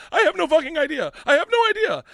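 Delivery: high, loud, rising yell of denial; panicked denial. Retold or imitated. Retold panicked denial to wife.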